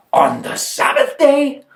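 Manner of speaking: high, slow scandalized question; shocked disbelief. Acted. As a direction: draw it out, appalled. Pharisee shocked at Sabbath work.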